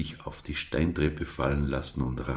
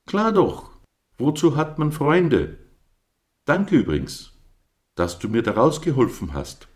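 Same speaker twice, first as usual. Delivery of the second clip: much higher, louder eager ram; cheerful Captain; 10 semitones higher, 8 dB louder than usual. A narrator's reading. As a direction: bounce it out like a glad friend.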